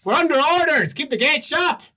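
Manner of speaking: high, loud guard calling down; guard on the wall refusing the party, high and loud; cheeky guard. Acted. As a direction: shout it down cheerfully from the wall.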